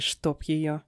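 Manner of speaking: usual speaking voice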